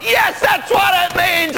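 a very high, loud, wild yell; crazy jumping shout. Retold or imitated. Priest leaps up at the bride, yelling wildly. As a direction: explode with glee at the bride. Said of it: "I jump up and down and I go crazy".

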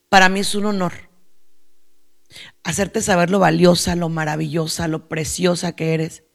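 softening, lowering into gentle sincerity; tender affirmation. Retold or imitated. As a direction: assure her softly of her worth. Tender words to a woman.

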